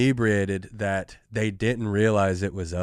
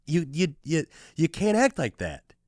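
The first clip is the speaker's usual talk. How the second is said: put-on higher, talking down to a drunk like a patient big brother; a pitched-up, imitated lecturing of himself; mock-reasonable lecture; 6 semitones higher than usual. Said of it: "I'm like".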